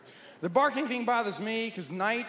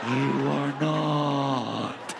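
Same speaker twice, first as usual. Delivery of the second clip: low, stretched snore forming words; snoring exhale; 9 semitones lower, 3 dB louder, much slower than usual. Acted. Snorer taunts the one awake, snoring words. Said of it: "how do you people make these noises".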